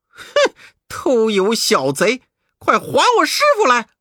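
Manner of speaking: high, loud furious scolding. Acted. Monkey King cursing the demon as an oil thief. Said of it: "悟空骂道".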